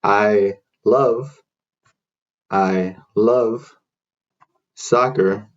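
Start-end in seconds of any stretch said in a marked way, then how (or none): none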